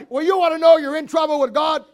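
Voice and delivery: normal talking voice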